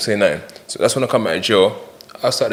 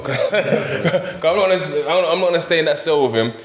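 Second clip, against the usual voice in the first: higher-pitched, amused telling through laughter; laughing; 7 semitones higher than usual. Real, not acted. Man recalling why he fled his cellmate, laughing as he talks. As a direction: laugh your way through the refusal.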